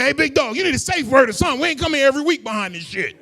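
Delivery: high, loud scolding yell; exasperated paramedic. Acted. Paramedic scolds the man he woke up, exasperated shouting. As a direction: holler it high, like a fed-up first responder.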